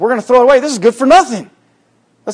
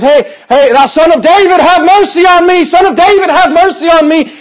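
high, loud desperate call for mercy; crying out; 11 semitones higher, 6 dB louder than usual. Retold or imitated. Blind man calling to Jesus passing by, loud high cry. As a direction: call across the crowd to be heard. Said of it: "he's crying this out".

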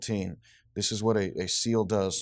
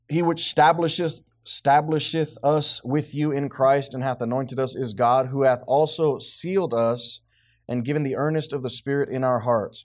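raised, emphatic verse reading; scripture reading; 4 semitones higher, 6 dB louder than usual. Real, not acted. Teacher reading on ownership, emphatic scripture reading. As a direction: read the verse out firmly, leaning on key words.

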